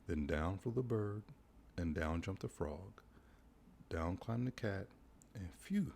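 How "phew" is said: much higher, relieved phew; a hammed-up sigh of relief; relieved dog phew. A narrator's reading. Dog relieved after the rescue, big high phew. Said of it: "said the dog".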